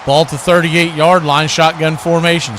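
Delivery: ordinary talk, nothing marked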